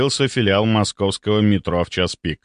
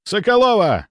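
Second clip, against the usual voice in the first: high, sharp summons; barked name; 9 semitones higher than usual. A narrator's reading. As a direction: bark at a junior to jump to it. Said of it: "рявкнул Мельников на девушку".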